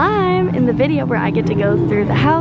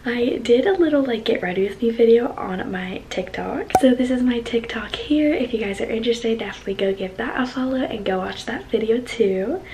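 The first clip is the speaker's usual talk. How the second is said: soft whispered vlog talk; whispering; 6 dB quieter than usual. Real, not acted. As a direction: keep it hushed, ASMR-like. Whispered vlog intro throughout.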